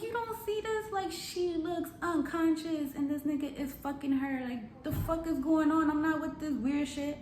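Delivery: high-pitched, exasperated retelling; appalled, high appeal to a friend; incredulous protest. Retold or imitated. Retold protest to the other girl.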